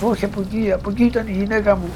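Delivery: high, fast shout of a frantic husband; imitating his shouting. Husband yells at rescuer where his wife was, high, fast and frantic. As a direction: mimic the panicked man pointing the wrong way. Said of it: "Τι φώναζε αυτός".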